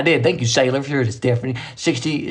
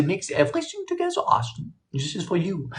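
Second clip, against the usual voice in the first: hushed aside; 3 semitones higher, 8 dB quieter than usual. Real, not acted.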